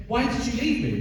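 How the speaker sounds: high-pitched, indignant retold complaint; pissed off. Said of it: "I was pissed".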